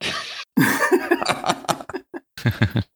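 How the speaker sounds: soft unvoiced laugh; breathy airy chuckle; breathy whispered laugh. Real, not acted. Co-host laughs at stifled giggling. (risa susurrada)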